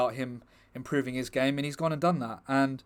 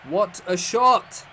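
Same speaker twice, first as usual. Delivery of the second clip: excited; 7 semitones higher, 4 dB louder, slower than usual. Real, not acted. Marvelling at the goal.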